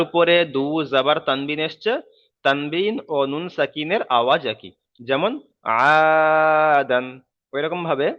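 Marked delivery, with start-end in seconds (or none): 5.8-7.0 long held "আ" opening "আদান"; slow, louder chanted model of "আদান"; madd elongation. Shown as a demonstration. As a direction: stretch the first vowel of "আদান" loudly and evenly, then "দান". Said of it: "এক আলিফ পরিমাণ টান দিয়ে পড়তে হয়".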